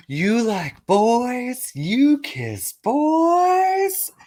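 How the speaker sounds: put-on very high, slow, squealed child taunt; shrill sing-song kid voice; mocking kids. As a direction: tease like a little brother. Little brothers mocking him.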